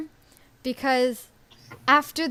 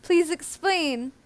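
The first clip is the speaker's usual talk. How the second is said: slow, drawn-out mock plea, rising higher on the repeat; imitating pleading viewers; 4 semitones higher, slower than usual. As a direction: beg like a confused audience, stretching each word. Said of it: "everybody's been like".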